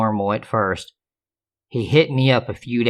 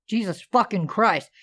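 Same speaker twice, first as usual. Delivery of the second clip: angry dad; 9 semitones higher than usual. Retold or imitated. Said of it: "my father was like".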